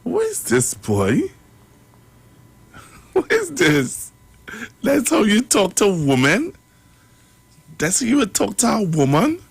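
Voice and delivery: who does he think he is, said high and appalled; incredulous outrage. Real, not acted. Outraged at the clip's threats.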